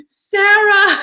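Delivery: high, loud mock call-out of a name. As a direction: imitate him shouting names across the set. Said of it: "calling people out by name".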